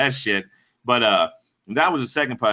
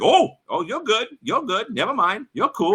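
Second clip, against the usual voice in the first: high, hasty appeasing imitation; mock a bully suddenly backing off; nervous backing down; 7 semitones higher than usual. Bully recognizes him at the fountain, nervously backing down.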